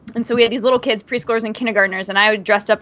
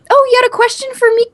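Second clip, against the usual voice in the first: high, louder put-on voice; teacher quoted; 13 semitones higher, 5 dB louder than usual. Retelling the exchange as the class leaves, high put-on voice. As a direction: pitch it up as a mock question to a child. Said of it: "the teacher goes".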